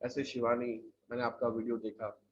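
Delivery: mimicked low, soft, flat-range voice; low range speaker. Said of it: "वो थोड़ा सा ऐसे ही बोल रहा है". (कम रेंज वाली धीमी, नीची आवाज़ की नकल)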